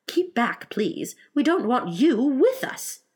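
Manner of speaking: high, slow, firm dismissal; shooing away. Acted. Alice imagines addressing the porpoise, firm and high. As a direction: warn off politely, stressing it.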